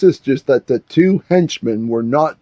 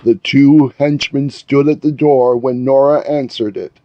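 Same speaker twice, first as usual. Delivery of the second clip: ordinary delivery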